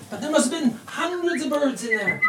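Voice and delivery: broad rustic Cornish storytelling, rising high; hammed-up Cornish accent. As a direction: lay the Cornish on thick, marveling. Radio host hamming up a rustic tale.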